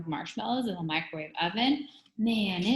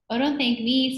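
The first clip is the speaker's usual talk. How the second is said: lightly voiced girl, high and loud; a touch of schoolgirl, pitched up; Allison's voice; 7 semitones higher, 7 dB louder than usual. A narrator's reading. Teacher fakes pupil's reply to visitor, high girlish voice. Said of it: "said Allison's voice".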